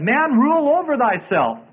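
high, swooping pronouncement; lofty recited command; proclaimed maxim. Acted. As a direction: intone it as a grand motto.

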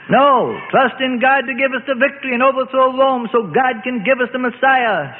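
high, insistent rallying cry; narrated shout, held high to the end; zealous shout. A narrator's reading. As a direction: shout it as a zealot, pitched well up. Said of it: "shouted the zealots".

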